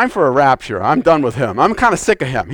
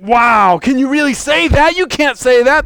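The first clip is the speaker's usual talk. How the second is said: pitched-up, lightly acted objection; mock disbelief; 6 semitones higher than usual. Church world objecting to the bride, mock disbelief. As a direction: voice a scandalized doubter, higher.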